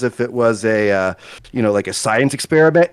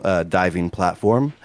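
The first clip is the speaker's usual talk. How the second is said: ordinary talk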